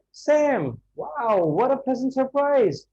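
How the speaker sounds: high, drawn-out pleasant surprise; amazed greeting. Shown as a demonstration. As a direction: stretch the name and wow in delighted amazement. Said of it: "he will be saying".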